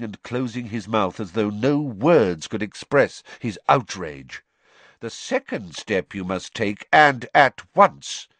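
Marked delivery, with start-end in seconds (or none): 5.0-8.3 insist, no pause; pressing on. A narrator's reading. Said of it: "Dumbledore pressed on".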